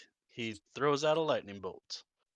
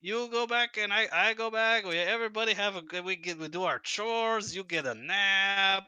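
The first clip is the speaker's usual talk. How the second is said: high NPC voice; 8 semitones higher than usual. Acted. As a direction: shoo them off cheerfully. NPC shooing player to bed.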